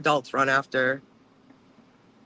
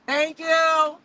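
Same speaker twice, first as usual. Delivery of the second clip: much higher, louder, exaggerated sweet "thank you"; sarcastic thanks; 13 semitones higher, 7 dB louder than usual. Driver thanking a lady who would not let her out, sarcastic. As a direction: overdo the gratitude so it is plainly fake. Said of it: "I was being sarcastic to".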